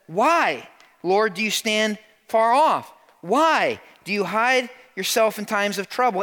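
high, loud cry of protest to God; impassioned lament. Acted. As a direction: plead it out with preacher's force. Preacher acts out psalmist's complaint.

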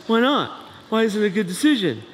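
put-on teen challenging dad over the car; whiny teen. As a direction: argue like a pouty teenager. Teen arguing with parent.

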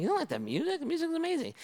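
high, soft astonished question; mock shock. Pastor reacting to the teen, high mock astonishment. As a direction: play up the disbelief.

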